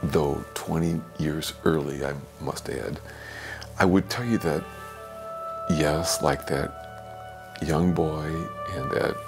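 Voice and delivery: usual speaking voice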